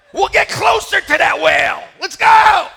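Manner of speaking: high, loud rallying call; pumped-up guide. Retold or imitated. Tour guide urging the boat toward the whale, excited shout. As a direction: fire up the tourists.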